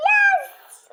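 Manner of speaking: very high, loud shriek of joy; triumphant yes. Real, not acted. Celebrating the bonus she just got, shrieking yes. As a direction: scream it in victory.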